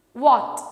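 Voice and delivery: what said loud with rounded lips; what as wot, lips rounded like O; correct what. Shown as a demonstration. Teacher giving the correct what after the wrong one. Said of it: "the correct one is".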